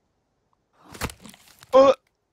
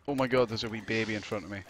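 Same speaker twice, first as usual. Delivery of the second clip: ordinary delivery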